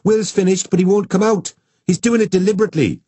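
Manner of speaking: put-on high, loud, tattling little girl; whiny child. A narrator's reading. Child complaining to mum.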